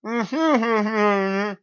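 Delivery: high, loud garbled laugh through a gun; mouth-blocked laughing sound; muffled laugh. Acted. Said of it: "It comes out as".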